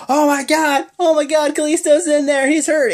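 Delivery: very high, loud hammed-up panic; mock panic. Staff panicking about Kalisto in the dumpster, high mock panic. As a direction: shriek it like overdramatic staff.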